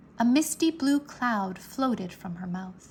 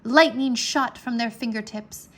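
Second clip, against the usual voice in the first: ordinary delivery, nothing marked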